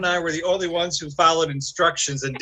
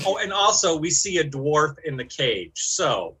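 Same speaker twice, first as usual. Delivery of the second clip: normal talking voice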